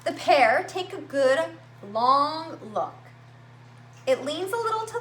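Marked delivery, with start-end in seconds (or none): none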